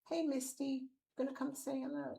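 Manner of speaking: high-pitched coaxing call to a cat; calling a pet. Real, not acted.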